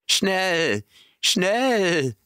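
high, urgent calling out; aufgeregter Ruf. A narrator's reading. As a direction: shout it in alarm, high. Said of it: "rief er". (hoher, aufgeregter Ruf)

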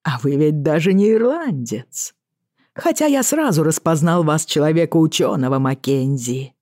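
casual lilting Irish sea captain; offhand, in a light Irish brogue; Irish accent. Acted. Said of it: "Боннет весело хмыкнул".

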